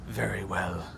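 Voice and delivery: low, sickly rasp; sickly gravelly voice. Acted. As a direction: agree in a deep, gravelly voice. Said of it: "very sickly, gravelly voice".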